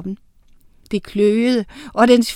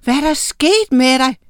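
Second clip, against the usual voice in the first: forskrækket, højt spørgsmål; råb spørgsmålet med højere tone; 4 semitones higher, 6 dB louder than usual. A narrator's reading. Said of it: "råbte Miranda". (oprevet udråb)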